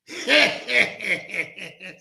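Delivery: imitated burst of dad's laughter; acted-out string of chuckles; dad cracking up. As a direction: crack up like an amused dad on the phone. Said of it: "He's cracking, he's laughing".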